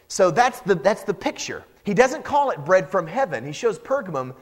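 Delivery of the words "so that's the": ordinary talk, nothing marked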